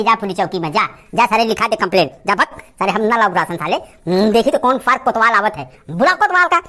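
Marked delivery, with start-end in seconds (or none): none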